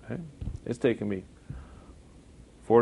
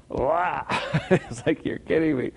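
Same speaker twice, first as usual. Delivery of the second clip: laughing, higher "you're kidding me"; mock disbelief; 6 semitones higher, slower than usual. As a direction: react in amused disbelief. Mock reaction to commercials.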